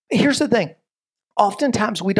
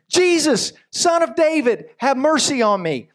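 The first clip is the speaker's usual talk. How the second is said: high, raised call for mercy; 7 semitones higher than usual. Acted. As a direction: call out across the crowd. Blind beggar calling out to Jesus.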